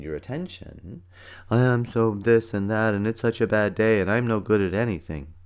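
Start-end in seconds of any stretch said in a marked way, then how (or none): 1.5-5.3 slightly slowed, louder mock self-pity; poor me. Imitating a kid having a pity party, poor-me voice. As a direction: play the victim, laying it on loudly. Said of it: "they play the poor me game".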